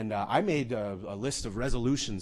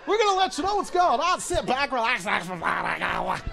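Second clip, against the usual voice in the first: mimicked DJ captain; 11 semitones higher, 4 dB louder than usual. Captain telling passengers to relax, hammed-up DJ patter. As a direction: start high and fast, trail into repeated words.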